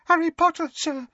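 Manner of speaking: terrified house elf squeaking his master's name. Acted. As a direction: squeak it like a frightened little elf. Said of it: "squeaked the elf".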